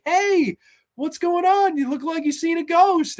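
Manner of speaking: put-on high, sweet girlish voice for Tomie; mock-innocent high voice. Voicing Tomie greeting him.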